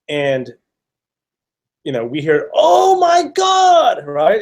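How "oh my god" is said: high, drawn-out thrilled shout; retold scream. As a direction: stretch it out high and slow, overjoyed to spot a friend. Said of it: "just Debbie screaming".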